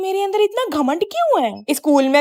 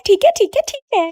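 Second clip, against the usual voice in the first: put-on eager kids agreeing; 6 semitones higher than usual. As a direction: agree eagerly like an excited child. Kids cheer their agreement. (उत्साह से हामी भरते बच्चे)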